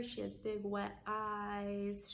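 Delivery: ordinary delivery, nothing marked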